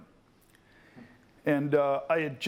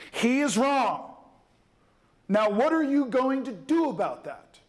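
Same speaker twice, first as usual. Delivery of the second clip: Fishburne challenging; 7 semitones higher, 3 dB louder than usual. Retold or imitated. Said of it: "He went".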